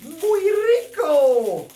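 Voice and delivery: loud, high gushing in Spanish with drawn-out vowels; hammed-up Spanish rapture; excited exclamation. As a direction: cry out in over-the-top delight, stretching the words. Opening exclamation over a crystal.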